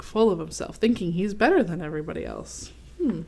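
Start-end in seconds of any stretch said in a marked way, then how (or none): none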